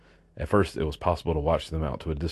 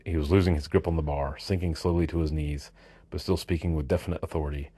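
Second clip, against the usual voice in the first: plain reading voice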